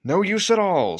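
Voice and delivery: high, loud, sulky retort; grumpy refusal. A narrator's reading. Gordon grumbling at Edward's driver, loud and sulky. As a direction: brush them off crossly. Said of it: "said Gordon".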